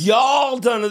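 mock Southern exclamation; fake Southern accent. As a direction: overdo a Southern accent for clout. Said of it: "they write their own character".

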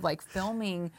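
ordinary talk

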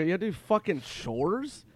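high, slow mock-outraged question; incredulous. Friend questioning the chores excuse, slow incredulous retelling. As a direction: repeat it back in disbelief, dragged out.

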